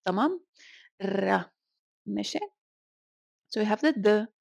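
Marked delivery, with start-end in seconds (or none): 1.0-1.4 "Ra" with a rolled Spanish R; "Ra" opened on a strong tongue-tip roll. Shown as a demonstration. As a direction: trill the R hard in "Ra". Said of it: "It's a strong R".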